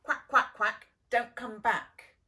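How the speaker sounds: loud, jeering duck voices; quacking ducks. Acted. Ducks jeering at the duckling, loud quacking taunt. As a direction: honk it out like rude ducks chasing him off. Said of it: "quacked the ducks".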